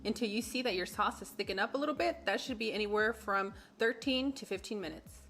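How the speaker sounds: loud, upbeat recipe narration; cooking show host. Acted. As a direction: present the recipe like a TV cook.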